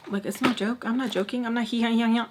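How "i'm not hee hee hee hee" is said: ordinary talk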